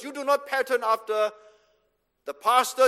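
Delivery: usual speaking voice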